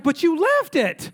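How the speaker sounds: high, slow, incredulous comeback; exasperated retort. Retold or imitated. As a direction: throw it back in disbelief. Acts out his exasperated retort.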